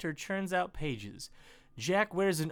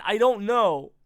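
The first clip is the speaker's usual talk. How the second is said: ordinary delivery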